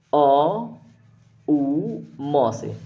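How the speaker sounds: अ, उ, म drawn out one by one; sounds of Om. Shown as a demonstration. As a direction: sound out अ then उ then म slowly. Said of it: "देखिए ओम का निर्माण कैसे होता है". (ओम के अ, उ, म अलग-अलग बोलकर)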